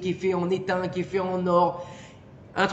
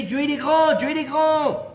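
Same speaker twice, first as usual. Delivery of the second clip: mock shouted call; 7 semitones higher than usual. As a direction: cry it out as if all day long. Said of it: "eux qui nous crient toute la journée".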